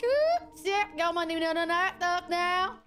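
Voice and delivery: high, slurred Southern twang, barely intelligible; incomprehensible Southern twang. Shown as a demonstration. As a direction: let the Southern twang run away into gibberish. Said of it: "If you understood any of that".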